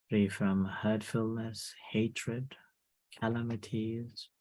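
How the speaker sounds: slow, soft meditation voice; guided meditation. Acted. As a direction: gently wish them well. Leads a loving-kindness meditation.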